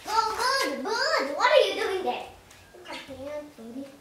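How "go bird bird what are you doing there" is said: high, loud calls to the bird; excited calling. Real, not acted. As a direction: urge the bird to come over.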